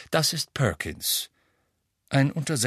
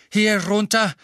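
loud, high breathless call; panting shout; 9 semitones higher, 7 dB louder than usual. A narrator's reading. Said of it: "keuchte Mister Weasley".

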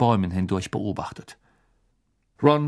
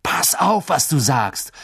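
called out; 3 semitones higher, slower than usual. A narrator's reading. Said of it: "rief Ron".

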